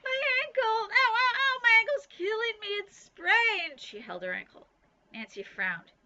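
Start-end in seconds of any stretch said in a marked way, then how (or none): 0.0-3.8 hammed-up high, loud cries of pain; fake wailing. Bean fakes an injury to get away from Nancy, hammed-up wailing. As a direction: overact a sprained ankle to escape. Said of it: "started to wail".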